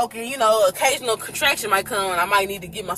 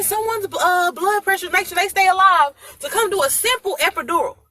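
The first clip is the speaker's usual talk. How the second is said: angry doctor; 10 semitones higher than usual. Retold or imitated. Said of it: "this man cussed them from ear to asshole".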